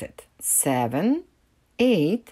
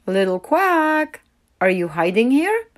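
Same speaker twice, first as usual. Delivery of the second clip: calling along the shore; called; 6 semitones higher, 5 dB louder than usual. A narrator's reading. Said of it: "she called".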